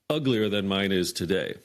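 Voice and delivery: normal talking voice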